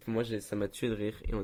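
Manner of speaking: ordinary delivery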